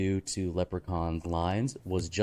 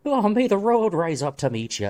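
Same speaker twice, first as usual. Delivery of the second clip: leprechaun line; 10 semitones higher, 4 dB louder than usual. Pretend. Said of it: "he lets out a".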